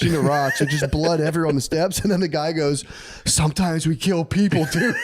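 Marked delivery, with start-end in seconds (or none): 3.2-4.9 laughing. Retold or imitated. Native guy casually confesses.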